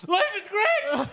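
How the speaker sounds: high, loud, drawn-out fake wail; mock crying. Husband at the swingers club, hammed-up crying. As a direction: wail it in a put-on tearful voice. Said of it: "then he starts crying".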